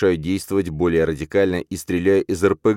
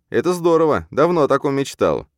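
feigned delight; 9 semitones higher, 4 dB louder than usual. Acted. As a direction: overplay the excitement sarcastically. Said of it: "наигранно отвечаю я". (наигранный восторг)

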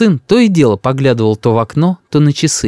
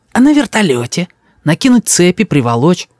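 put-on eager little boy; 6 semitones higher than usual. Grandson plots with grandfather.

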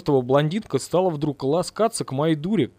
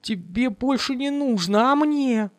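slow, high wailing complaint; high slow lament; 10 semitones higher, slower than usual. Acted. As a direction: wail at the dead man. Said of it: "взвыла Лиза".

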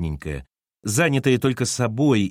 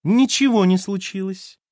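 high, sweetly false mermaid voice; 9 semitones higher, 4 dB louder than usual. A narrator's reading. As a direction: lure him with a false sing-song. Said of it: "фальшивым русалочьим голосом". (фальшивым русалочьим голосом)

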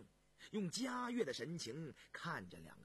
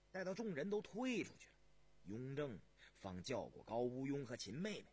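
plain reading voice

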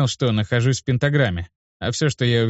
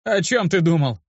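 higher-pitched, mildly annoyed question; irritated; 6 semitones higher than usual. A narrator's reading. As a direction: demand an explanation with a touch of irritation. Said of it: "раздражённо спросил я". (с лёгким раздражением)

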